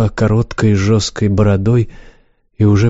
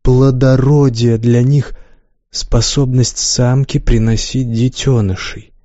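usual speaking voice

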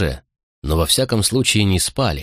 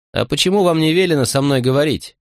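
higher-pitched, lightly curious question; 7 semitones higher than usual. A narrator's reading. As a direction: ask as if innocently intrigued. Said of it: "с любопытством спросил я". (с любопытством, голос повыше)

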